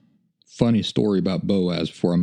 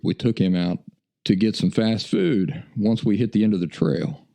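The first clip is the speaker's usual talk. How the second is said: ordinary talk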